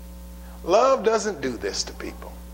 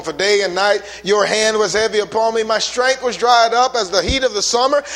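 higher and louder scripture proclamation; full-force preaching; 5 semitones higher, 4 dB louder than usual. Real, not acted. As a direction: proclaim the verses, climbing.

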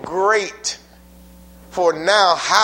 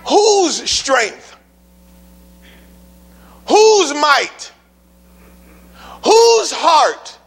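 rising from loud to full shout; building shout; 8 semitones higher, 6 dB louder, much slower than usual. Real, not acted. Preacher shouting questions at the congregation, building to a full shout. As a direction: hammer each whose harder.

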